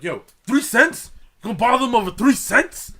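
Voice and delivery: loud, high incredulous complaint; indignant customer. Retold or imitated. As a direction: imitate an outraged man in line. Imitating indignant customer behind.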